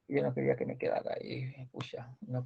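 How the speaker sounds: very low, whispered grumble; muttering to self. Real, not acted. Teacher fussing over what got left there, muttering to self. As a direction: mutter it under your breath, barely voiced.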